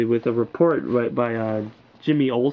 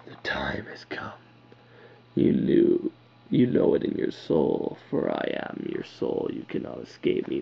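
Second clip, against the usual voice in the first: low, ghostly whisper of a voice inside his head; eerie possessed whisper; possessed inner voice; 11 semitones lower, 4 dB quieter than usual. Retold or imitated. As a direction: hiss it like a spirit speaking from within. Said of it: "like he's possessed".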